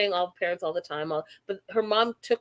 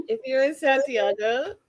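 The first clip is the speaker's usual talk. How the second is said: normal talking voice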